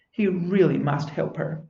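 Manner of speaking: ordinary delivery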